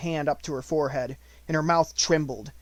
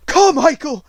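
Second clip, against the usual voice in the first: much higher, much louder cry; anguished cry; 13 semitones higher, 15 dB louder than usual. Acted. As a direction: scream the name in shock. Shock at hearing Captain Crewe.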